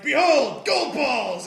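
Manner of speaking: high, loud mock-heroic reveal; hammed-up superhero declaration; grand proclamation. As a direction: announce a silly power with great pomp. Acting out hero announcing silly power.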